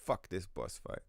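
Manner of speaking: quiet deadpan mock grumble; voicing the game. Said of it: "The game was like".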